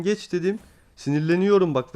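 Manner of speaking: usual speaking voice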